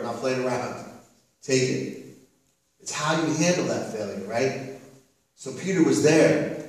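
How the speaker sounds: usual speaking voice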